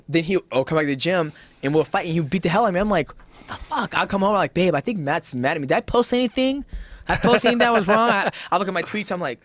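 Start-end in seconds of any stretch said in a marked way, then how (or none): 3.1-4.0 baffled whisper. Retold or imitated. Retold baffled reaction. 4.6-9.4 higher, anxious fretting voice; mock-anxious, higher pitched self-talk; worried self. Retold worried talk to wife.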